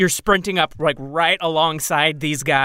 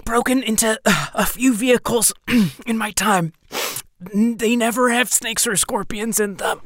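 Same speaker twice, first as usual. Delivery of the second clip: higher, cocky rogue voice; character voice; 7 semitones higher than usual. Acted. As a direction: boast like a seasoned thief. Player voices his character.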